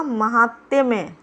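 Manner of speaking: महात्म्य slowed right down, falling; syllable-by-syllable answer. Shown as a demonstration. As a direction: break महात्म्य up as ma-haat-te-me. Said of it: "इसमें क्या होगा". (महात्म्य को तोड़कर धीरे बोलें)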